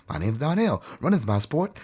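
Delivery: a hint of the chirpy Baywatch kid; lightly voiced mock introduction; Donnell voice. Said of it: "I hear that character".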